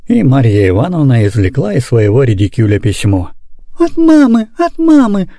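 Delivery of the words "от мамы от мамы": put-on very high, excited child voices; children crying out. A narrator's reading. Children seeing mama's letter, high excited cry. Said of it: "закричали дети".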